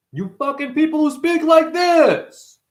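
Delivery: high, slow put-on voice; character voice. Mocking people who talk in character voices, high and slow. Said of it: "These characters of everybody".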